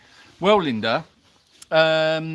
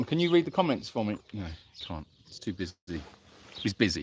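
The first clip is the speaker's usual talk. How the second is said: usual speaking voice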